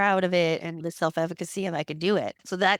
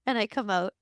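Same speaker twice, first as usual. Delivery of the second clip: normal talking voice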